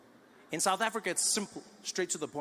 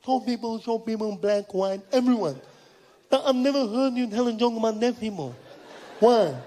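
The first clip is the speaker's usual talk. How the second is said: deaf man's speech; 4 semitones higher, 8 dB louder than usual. Retold or imitated. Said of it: "He said".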